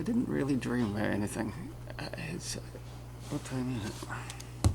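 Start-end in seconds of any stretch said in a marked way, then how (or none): 2.0-4.7 whispering. Real, not acted. Early morning, voice unvoiced.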